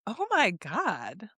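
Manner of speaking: drawn-out, slow mock gasp of horror; appalled disbelief. Recalling her reaction to the nasty video, appalled disbelief. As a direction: stretch it out, scandalised.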